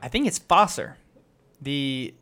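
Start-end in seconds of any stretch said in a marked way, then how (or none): 0.4-0.9 "Vosser" said with an F, as Fosser; corrected pronunciation. Shown as a demonstration. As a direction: start "Vosser" on an F sound, not V. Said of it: "an F".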